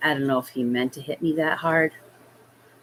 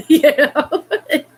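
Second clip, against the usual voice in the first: higher, laughing through the words; 10 semitones higher, 4 dB louder than usual. Real, not acted. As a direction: laugh it off while talking. Laughing at childhood memory.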